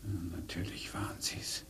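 quiet unvoiced whisper of resignation; bitter whisper. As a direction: admit it to yourself softly. Bitter realisation alone.

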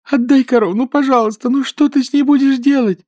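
высоко и громко, сквозь плач; умоляет хозяина, заливаясь слезами; рыдая. A narrator's reading. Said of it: "безутешно рыдала Нюра". (умоляющий плач)

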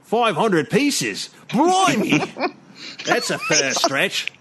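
slow, incredulous Cockney haggler with a high yelp; hammed-up Cockney-Australian disbelief; Australian-sounding Cockney. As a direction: balk at the price in Australian-tinged Cockney. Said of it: "It's Australian".

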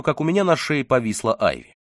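ordinary delivery

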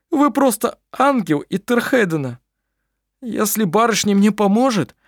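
an overwhelmed man vowing devotion; tearful grateful man. A narrator's reading. Klampin's tearful gratitude.

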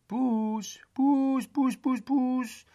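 high, loud calling to a cat up a tree; coaxing cat call. A narrator's reading. Crowd on the street calling up to the cat, coaxing and loud. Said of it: "riep iedereen bemoedigend".

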